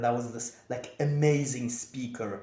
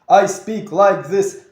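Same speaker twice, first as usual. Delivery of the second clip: loud, higher-pitched robotic delivery; self-mocking robot voice, raised and forceful; robot-like speech; 7 semitones higher, 12 dB louder than usual. Shown as a demonstration. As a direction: push out each word like a robot. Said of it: "I speak like a fucking robot".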